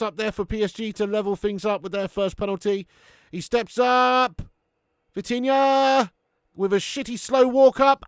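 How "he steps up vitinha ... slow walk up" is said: slow, drawn-out and loud, pitched up; live commentary holding the moment; tense build-up. Real, not acted. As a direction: stretch each word as he walks up. Building tension before penalty.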